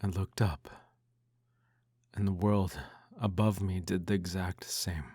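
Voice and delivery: ordinary delivery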